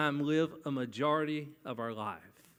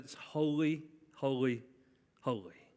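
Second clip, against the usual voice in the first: holy, holy, holy, each fading softer; emphatic repetition; 2 semitones lower, 4 dB quieter than usual. Shown as a demonstration. Preacher showing Hebrew emphasis by repeating, fading. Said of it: "if we really wanted to emphasize it".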